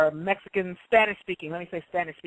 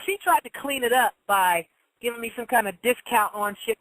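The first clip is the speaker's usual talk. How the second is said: ordinary delivery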